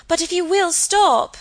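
high, loud appeal; crying out. A narrator's reading. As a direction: call after someone leaving. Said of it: "cried Margaret".

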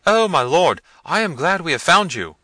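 high, loud relieved servant; agitated servant. A narrator's reading. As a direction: blurt it up high to your master. Servant finds his master.

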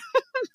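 brief high chuckle; short amused giggle; laugh. Real, not acted. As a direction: let a soft giggle slip out. Laughing at her own aside.

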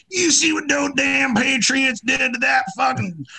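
high, loud mock rant; angry mock loyalist yelling in the tavern. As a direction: shout it like a drunk loyalist in a tavern. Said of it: "You'd be like".

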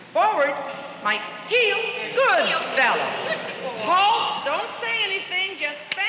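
high, carrying calls; calling out commands. Real, not acted. Trainer drilling owners and dogs, calling high over the hall. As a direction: bark the drill across the floor.

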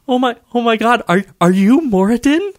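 high, excited squeak; breathless high-pitched fangirling; starstruck fan. Acted. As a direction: gush nervously at your idol. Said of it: "such a big fan".

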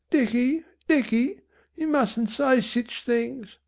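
high, slow, soft pleading; tearful mother. A narrator's reading. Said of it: "with tears in her slack eyes".

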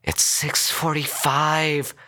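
high, slow exasperated groan. A narrator's reading. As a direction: drag it out in a groan. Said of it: "the woman groaned".

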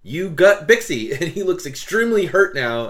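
ordinary talk, nothing marked

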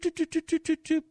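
high, quick "doot, doot" like something dropping down; high doot-doot noise. Retold or imitated. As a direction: sing out tiny high doots for the spider lowering itself. Imitating spider dropping down.

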